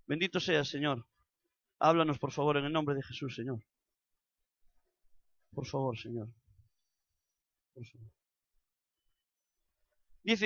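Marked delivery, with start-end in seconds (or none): 0.1-8.1 soft, pleading prayer under the breath; hushed prayer. Real, not acted. Preacher pausing the sermon to pray softly. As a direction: pray quietly, almost to yourself.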